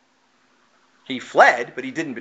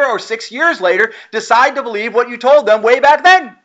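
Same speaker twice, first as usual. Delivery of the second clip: higher and louder, driving the point home; full-force preaching; 7 semitones higher, 5 dB louder than usual. Acted. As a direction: preach it out to the congregation, building.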